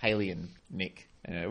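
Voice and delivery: ordinary delivery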